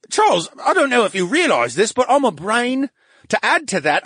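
high, loud, baffled brain voice; protesting he cannot be pregnant; indignant brain. Acted. AB confused protest.

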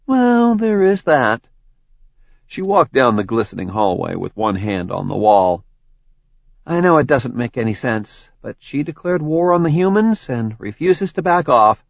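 friendly, chatty woman explaining; Tori's voice. Acted. Tori wry reply.

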